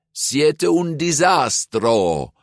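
slow, high-pitched exasperation; loudly fed-up grumble; grumbling. A narrator's reading. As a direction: complain with drawn-out emphasis. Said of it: "mopperde Giulia".

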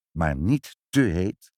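ordinary delivery, nothing marked

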